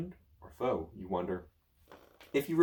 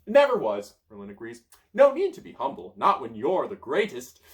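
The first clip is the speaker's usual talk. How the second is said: smug wizard; 9 dB louder than usual. Acted. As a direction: revel in the greatness. Merlin boasting.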